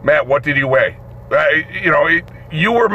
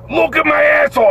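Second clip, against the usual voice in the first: high-pitched, insistent demand; imitated prisoner's raised outcry; inmate hollering; 10 semitones higher than usual. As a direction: yell it from the cell. Inmate yelling his complaint at guard.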